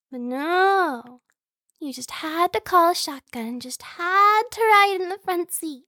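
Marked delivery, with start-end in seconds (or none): 1.8-5.8 higher, put-out whine; playful complaint. Acted. Sibling teases about calling shotgun, playful complaint. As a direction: complain with stress on each grievance.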